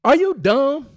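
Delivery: high, loud scornful shout; mock yell. Shown as a demonstration. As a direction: snap it at him loudly. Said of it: "Instead, say".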